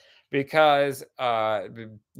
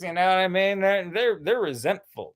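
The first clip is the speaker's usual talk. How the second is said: ordinary talk, nothing marked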